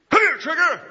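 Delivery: loud, high call to a horse; cowboy calling his horse Trigger. Acted. Preacher as cowboy calls his horse, loud. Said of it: "hero calling his horse".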